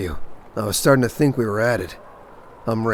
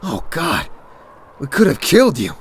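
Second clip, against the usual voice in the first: higher, startled outburst after a scare; shaken exclamation; 5 semitones higher, 3 dB louder than usual. Acted. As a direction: blurt it out, rattled by the near miss. Nearly shot a stranger.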